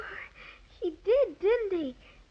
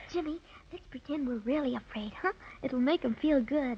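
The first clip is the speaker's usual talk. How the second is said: much softer, lower, a secret plan; conspiratorial hush; 4 semitones lower, 8 dB quieter than usual. Acted. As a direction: hush it so the bear can't hear. Girl plots secretly with brother.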